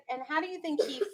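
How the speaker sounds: ordinary talk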